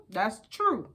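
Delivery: ordinary talk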